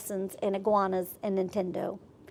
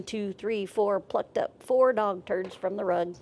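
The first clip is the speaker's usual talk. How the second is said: ordinary delivery, nothing marked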